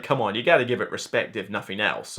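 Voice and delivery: normal talking voice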